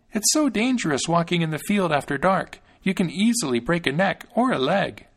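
put-on high, small voice of a thumb-sized boy; little Thumbling, fretful; Thumbling's tiny voice. A narrator's reading. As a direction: pitch it up like a tiny hero.

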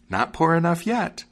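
high-pitched bird taunt; high, vengeful little bird; taunting sparrow. A narrator's reading. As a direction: pipe it high, rising at the end. Sparrow taunting the ruined wagoner.

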